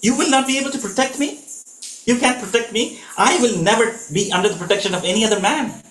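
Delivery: a wife refusing to be left behind; enraged Sita. Retold or imitated. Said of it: "Now this enrages Sita".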